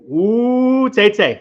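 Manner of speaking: high, loud whoop of a name; real, playful high-pitched shout-out; excited cheer. Real, not acted. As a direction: hype up a viewer with a rising ooh.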